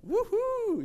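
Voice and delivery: very high, loud woohoo; playful mock whoop of delight; excited whoop. Mimicking singles excited over someone.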